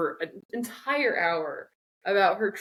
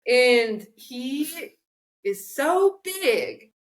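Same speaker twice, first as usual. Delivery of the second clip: high, slow hammed-up monster tale; dramatic storyteller; 7 semitones higher, 4 dB louder, much slower than usual. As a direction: ham up the big beast story. Acting out the beast story.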